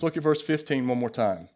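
usual speaking voice

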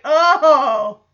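loud, drawn-out "oh"; imitated audience heckler. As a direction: call out like one guy in the crowd. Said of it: "But one guy's like".

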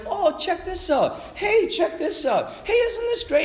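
high, slow sing-song sales pitch; a wheedling friendly tempter; sing-song coaxing. Retold or imitated. Gentle Greek persuasion mocked.

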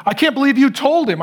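a hint of a furious husband; mock angry yell. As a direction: bark it at her like the angry husband. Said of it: "he yelled at her".

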